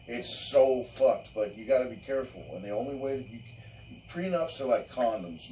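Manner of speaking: ordinary talk, nothing marked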